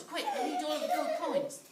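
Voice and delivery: high, fast excited rush; hurried grab. A narrator's reading. Jack snatching gold coins, fast and high. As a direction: grab it all in a hurry.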